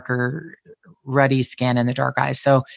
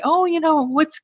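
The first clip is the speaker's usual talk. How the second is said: much higher, giggly teasing voice; giggly women; 14 semitones higher than usual. Retold or imitated. Giggling women teasing about Mark, high mock squeal. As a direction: squeal it like tittering ladies. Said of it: "who would giggle really hard when they would say".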